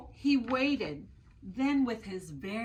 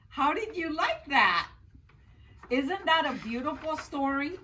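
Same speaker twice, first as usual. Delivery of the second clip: bright and excited; 7 semitones higher, 5 dB louder than usual. Real, not acted. Storyteller addressing children.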